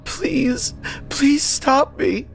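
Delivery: high, slow sobbing plea; desperate high-pitched begging through tears; tearful pleading. A narrator's reading. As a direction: beg in a high childlike sob. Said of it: "started to sob like a child".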